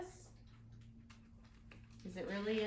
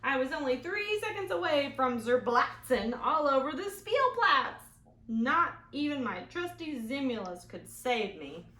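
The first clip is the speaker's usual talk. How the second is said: put-on lively read-aloud for kids; storybook reading; 4 semitones higher, 9 dB louder than usual. A narrator's reading. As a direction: tell the story to the children. Reads picture book aloud.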